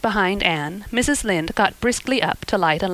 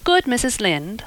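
ordinary delivery

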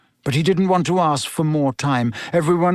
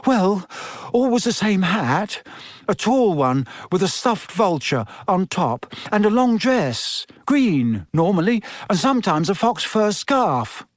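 higher-pitched, hesitant schoolboy; Neville; 6 semitones higher than usual. Acted. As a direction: list things haltingly, unsure why you were asked.

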